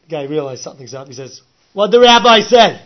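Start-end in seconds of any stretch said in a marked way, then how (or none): 1.7-2.8 high, loud question; loud, high, demanding question. Retold or imitated. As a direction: bark it at the translator. Mafia guy demands the translation.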